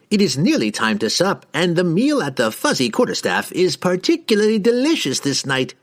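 high, breezy instruction; a cheerful host giving orders; wizard voice. A narrator's reading. Harkle voiced in narration.

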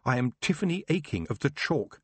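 put-on young witch bracing herself; resolute self-talk. A narrator's reading. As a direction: tell yourself who you are. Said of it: "she said to herself".

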